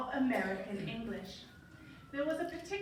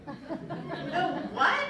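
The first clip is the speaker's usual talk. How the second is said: louder, higher shocked roommate; Raquel; 4 semitones higher, 7 dB louder than usual. Retold or imitated. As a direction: recoil in disbelief. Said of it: "Raquel said".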